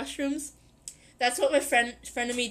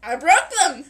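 ordinary delivery

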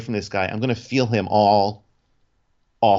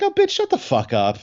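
mock inner retort. Inner retort to flight attendant.

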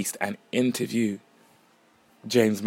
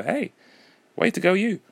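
high, sing-song self praise; playful pat on the back; mock cheer; 9 semitones higher than usual. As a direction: cheer yourself on in jest.

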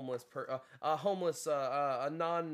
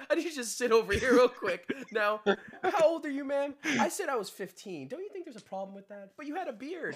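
higher, louder stern sting-show host; Chris Hansen; 7 semitones higher, 3 dB louder than usual. Retold or imitated. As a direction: grill him like a TV host catching predators. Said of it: "what are you, Chris Hansen".

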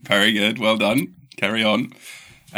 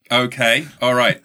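ordinary delivery

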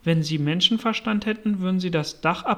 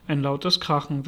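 ordinary delivery, nothing marked

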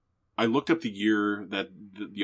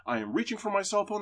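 higher, insistent repeat; urgent warning; 10 semitones higher, 4 dB quieter, faster than usual. Retold or imitated. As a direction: warn him steadily.

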